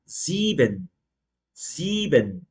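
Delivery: "sieben" as "ziiben", silent first E; long I. Shown as a demonstration. Teacher modelling "sieben" with lengthened I, no extra E. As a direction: stretch the I in "sieben". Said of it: "alongasse um pouquinho mais esse I".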